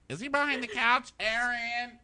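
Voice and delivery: high singsong search call; mock calling out. Looking for Aaron behind the couch, mock calling out.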